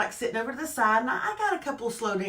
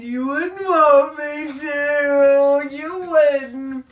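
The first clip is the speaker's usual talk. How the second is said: teasing; 2 semitones higher, 8 dB louder, much slower than usual. Real, not acted. Teasing David about Mark Wahlberg.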